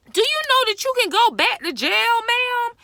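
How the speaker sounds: loud, high, rapid-fire scolding; real outraged warning. Real, not acted. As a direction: yell it at her as a fast, high-pitched question. Scolding someone, warning of jail.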